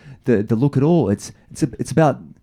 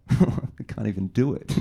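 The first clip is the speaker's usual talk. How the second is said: soft mock model line; 3 semitones lower, 6 dB quieter than usual. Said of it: "And I went".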